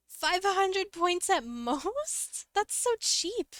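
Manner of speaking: incredulous. Real, not acted. Reacting to a low point price.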